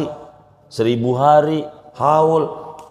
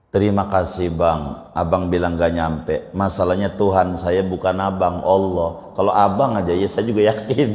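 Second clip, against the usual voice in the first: calm mock reply; 6 semitones lower than usual.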